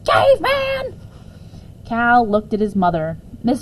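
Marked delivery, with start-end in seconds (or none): 0.0-1.0 screeching. Acted. Mother screeching at the neighbour from the window. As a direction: scream it out the window. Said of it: "she screeched".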